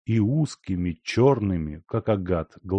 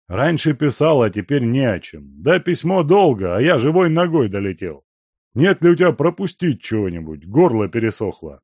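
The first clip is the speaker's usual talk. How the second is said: put-on loud, fast gruff old man; boisterous old miner; 4 semitones higher, 7 dB louder than usual. A narrator's reading. Guest asking the servant for a drink, loud and brisk. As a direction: demand a drink with a swagger.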